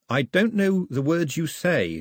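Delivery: put-on slow, careful boy voice. A narrator's reading. Boy telling the girl he lacks her words, slow. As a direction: speak slowly as to a stranger.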